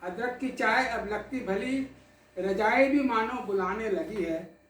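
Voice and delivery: measured, dramatic poetic recital with held pauses; poem recitation. Acted. Poet recites tribute to the audience, in recital cadence. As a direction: recite it like a poet at a memorial gathering.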